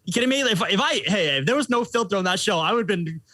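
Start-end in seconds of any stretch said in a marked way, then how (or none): 0.0-1.8 high, sharp disbelief; you kidding me, pitched way up; incredulous. Retold or imitated. Roasting reputation questioned, incredulous disbelief. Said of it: "I'm like".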